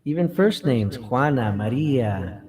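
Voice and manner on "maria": ordinary talk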